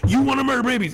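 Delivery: loud, high retort, hammed up; imitating a blustering debater's yell; mock yell. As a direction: bark the question back, mocking. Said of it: "he's just yelling louder".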